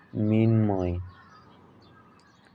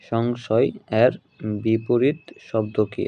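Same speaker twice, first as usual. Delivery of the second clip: slow, measured question with a pause after সংশয় এর; slow repeat; slower than usual. Real, not acted. Teacher repeating an exam question for students, slowly and deliberately. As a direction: draw out সংশয় এর, then বিপরীত শব্দ কি.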